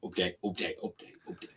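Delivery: very soft, repeated under the breath; hushed muttering. Real, not acted. Urging a betting update along, hushed muttering fading out. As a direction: let it fade into a whisper.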